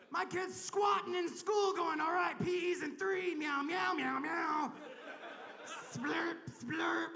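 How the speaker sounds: high mock-outraged shout, drawn out; hammed-up panic with cartoon meows; alarmist media. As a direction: rant like a scandalised parent on the news. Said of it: "the media says things like".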